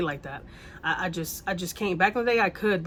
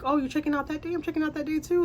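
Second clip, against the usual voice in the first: much higher, mocking girly voice; imitating the drunk neighbor; 9 semitones higher than usual. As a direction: mimic her chirpy surprise, pitched way up. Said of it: "And she's like".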